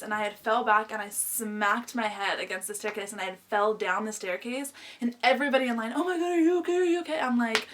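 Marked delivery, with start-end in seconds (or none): 5.9-7.2 high-pitched fussing bystanders; imitated alarmed concern. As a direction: mimic onlookers fretting, voice pitched up. Bystanders in line react to fall.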